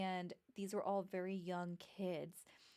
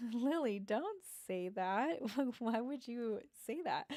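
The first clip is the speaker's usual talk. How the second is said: surprised; 4 semitones higher, 4 dB louder than usual. Retold or imitated. Reacting to child's strange claim.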